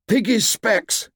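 high, drawn-out shout. A narrator's reading. Boy running uphill shouts for Piggy's glasses, urgent shout. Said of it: "shouted Ralph".

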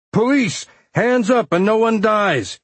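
high, forceful warning shout; shouted police command. A narrator's reading. Off-duty cop confronting gunmen in a store, shouting. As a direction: shout it to freeze armed robbers. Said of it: "he shouted".

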